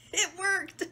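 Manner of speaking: high burst of laughter; delighted laughing. Real, not acted. Reader laughing as the rubber band flies.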